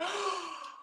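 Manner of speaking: a sharp mock gasp of delight; imitated gasp. Boyfriend spotting the cookies, imitated excited gasp. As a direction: mimic his excited gasp at the sight. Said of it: "ran up and was like".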